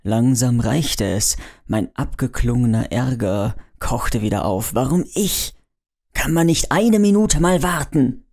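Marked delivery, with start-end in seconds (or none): 4.7-8.2 groggy traveller, fed up, calls out annoyed. Acted. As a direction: snap back, fed up and impatient. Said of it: "rief ich genervt".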